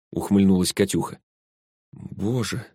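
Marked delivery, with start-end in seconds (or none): 1.9-2.7 soft, stunned murmur; hushed exclamation of shock; quiet babble. A narrator's reading. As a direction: barely voice it, in dismay. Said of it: "тихо пролепетала Зоя".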